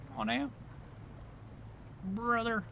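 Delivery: squeaky high whine; goofy high bro plea; silly clown. Pretend.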